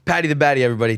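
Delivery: loud, high announcer call; announcer sign-off. Acted. Host presenting the fighter to viewers, loud announcer voice. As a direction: hype the name like a ring announcer.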